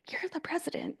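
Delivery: whispered aside. Real, not acted. Delegate pleading with the council, a whispered aside.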